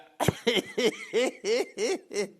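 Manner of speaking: high, long giggling fit; real squealing giggles; uncontrolled laugh. Real, not acted. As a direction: relapse into helpless laughter. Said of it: "I'll calm down".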